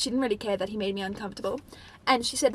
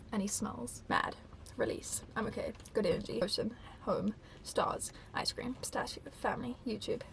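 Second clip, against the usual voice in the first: hushed little soothing mantra; self-calming; 10 dB quieter than usual. Retold or imitated. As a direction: read it like calming yourself down from anger. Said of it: "trying to, like, calm myself down".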